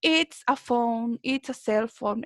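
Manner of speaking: slow and clear, every word spaced; careful teacher demo pace; slow model answer. Shown as a demonstration. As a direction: spell it out slowly for the class. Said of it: "me van a responder".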